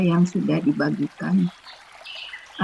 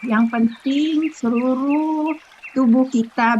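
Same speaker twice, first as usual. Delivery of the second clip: usual speaking voice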